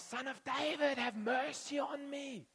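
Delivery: beg, drawn out and high; the blind beggar begging Jesus for mercy; pleading beggar. Retold or imitated. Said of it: "you know, Bartimaeus".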